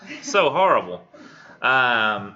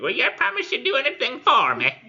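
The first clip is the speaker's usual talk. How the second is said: very high, louder screeching hag; terrible hag voice; 5 dB louder than usual. Acted. Hag bargains with the desperate knight, terrible high screechy voice. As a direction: screech it like a dreadful crone. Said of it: "terrible sounding voice".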